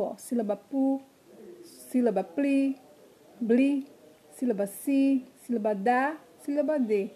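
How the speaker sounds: normal talking voice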